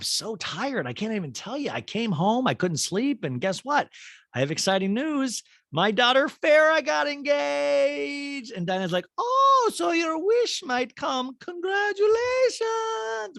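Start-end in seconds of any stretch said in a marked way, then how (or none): 0.0-8.5 raised, tired, then thrilled announcement; higher Kyle voice. Retold or imitated. Kyle voiced sharing news. 9.2-13.4 very high, slow, loud gushing; squealing Diana. Retold or imitated. Diana reacts to engagement.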